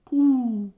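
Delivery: a single high, falling coo; pigeon coo. Pretend. Pigeon at the band shell, a high, falling coo. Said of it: "makes that pigeon noise".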